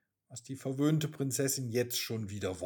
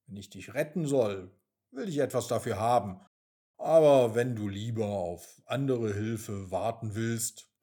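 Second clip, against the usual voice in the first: lower, unimpressed rat; cool rat; 6 semitones lower, 3 dB louder than usual. Acted. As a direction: refuse to bow, calm and sly. The rat answers coolly. (tiefe, gelassene Ratte)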